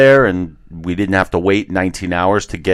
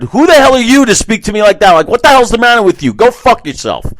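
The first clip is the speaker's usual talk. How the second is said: much higher, louder, fast furious rant; retold angry outburst; 14 semitones higher, 6 dB louder, faster than usual. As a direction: lose it and tear into her. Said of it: "And I just lost it".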